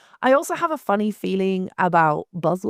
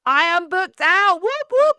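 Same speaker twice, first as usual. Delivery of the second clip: high, louder, slow gloating cheer; proud mock cheer; 9 semitones higher, 5 dB louder, slower than usual. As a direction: crow it out, over the top. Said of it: "I like to change that up".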